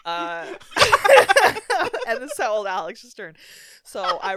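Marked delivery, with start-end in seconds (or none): 0.6-2.0 loud high burst of real laughter; cracking up mid-story. Real, not acted. Storyteller laughing at her own Lego story, loud real laugh.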